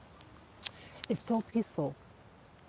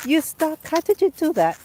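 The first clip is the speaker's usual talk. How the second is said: much higher and louder, sing-song pet voice; 10 semitones higher, 11 dB louder than usual. Real, not acted. Owner asking her stuck dog how it happened, high loud baby talk.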